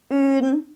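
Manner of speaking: un as "ün", held high; model ün. Shown as a demonstration. Teacher shows the reading with ü, high held ün. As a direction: keep lips pursed for ü in un, level. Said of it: "Jadi, bacanya".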